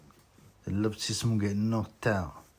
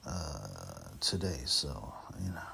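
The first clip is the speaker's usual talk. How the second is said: whisper; 9 semitones lower, 11 dB quieter, slower than usual. Real, not acted. Drops to whisper mid-sentence.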